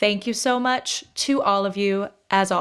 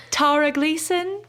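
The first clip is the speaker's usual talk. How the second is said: high proper British accent, higher pitch; slow, raised proper British English; Giles; 6 semitones higher than usual. Shown as a demonstration. Host shouts out a listener as Giles, proper British. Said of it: "That's my high proper British".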